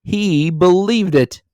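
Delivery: loud, high and slow; gleeful mock outrage; laughing disbelief. A narrator's reading. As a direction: relish the absurdity aloud. Nephew still crying out.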